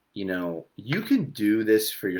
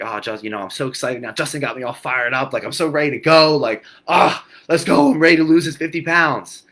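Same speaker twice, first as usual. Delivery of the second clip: louder and higher, hyped-up viewer voice; mock fired-up excitement; 4 semitones higher, 8 dB louder than usual. Coach imitating a viewer all fired up. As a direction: play the overeager newbie all fired up. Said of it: "Don't think".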